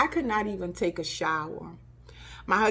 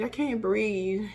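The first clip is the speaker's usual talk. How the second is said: fading, slower plea; slower, quieter repeat; 3 semitones higher, slower than usual. Retold or imitated. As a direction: let it sink, worried. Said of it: "And I said".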